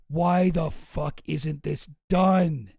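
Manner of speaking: very slow, drawn-out mock outrage; half-serious angry boss, heavily stretched; exasperated demand. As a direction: drag out each word like a fed-up boss. Retold boss demanding results from staff.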